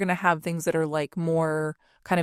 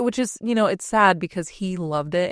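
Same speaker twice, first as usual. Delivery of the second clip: normal talking voice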